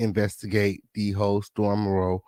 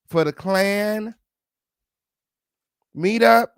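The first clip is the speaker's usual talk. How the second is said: higher, rising disbelief; incredulous rant; 6 semitones higher than usual. Real, not acted. Mocking Storm's stolen car story, high incredulous rant. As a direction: pile it on, building to a high peak.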